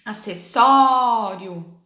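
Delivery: "acessório" with a loud, stretched, open Ó; open vowel. Shown as a demonstration. Teacher showing pupils the acute accent, open vowel. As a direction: lean long and hard on the open Ó of "acessório". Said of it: "deixa aquele som mais aberto".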